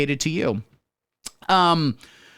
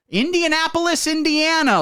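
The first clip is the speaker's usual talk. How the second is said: announcer; 4 dB louder than usual. Acted. Game-show announcer city intro.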